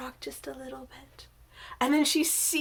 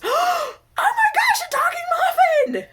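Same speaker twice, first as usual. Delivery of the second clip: very high, loud comic scream; gasp, then shriek the punchline in mock horror; shocked shriek; 10 dB louder than usual. Muffin's shocked punchline of joke.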